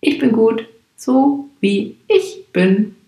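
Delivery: „so wie ich bin“ stretched very slowly into a singsong; slow melodic. Shown as a demonstration. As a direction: find a rhythm and a melody, slowly. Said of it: "so eine Melodie gefunden hat".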